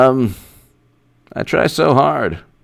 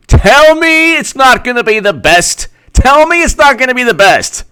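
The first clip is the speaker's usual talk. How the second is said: excited hype; 9 semitones higher than usual. Real, not acted. Fan hyping a Clinton versus Trump rematch. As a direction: sell the rematch with glee.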